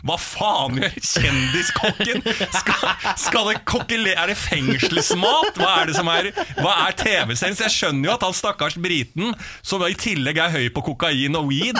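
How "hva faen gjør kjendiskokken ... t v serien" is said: slow, laughing, rising to a high squeal of confusion; mock outrage at the absurd scene; what on earth is going on here; baffled disbelief. Baffled rant about the chef.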